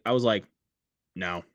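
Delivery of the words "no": straight-faced, matter-of-fact no; curt deadpan refusal. Retold or imitated. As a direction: say it with no expression at all. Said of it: "I just told him straight face".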